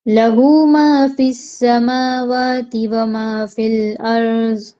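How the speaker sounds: slow, drawn-out tajweed chant; Quranic recitation. Shown as a demonstration. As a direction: recite it slowly, stretching each vowel. Recites Ayat al-Kursi.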